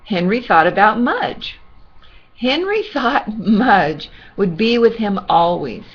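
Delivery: plain reading voice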